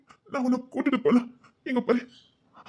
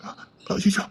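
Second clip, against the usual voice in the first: whispered gasp; 2 semitones lower, 6 dB quieter, much slower than usual. Acted. Shocked at her injury.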